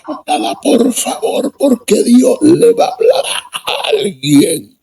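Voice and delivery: high tearful mock plea; preacher's plea. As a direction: beg the crowd like the preacher did. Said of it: "Entonces dice".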